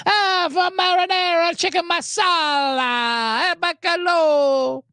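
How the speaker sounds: high, slow hammed-up Italian-American accent; slow cartoon Italian-American listing dishes; mock Italian food order. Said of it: "it just goes into food orders".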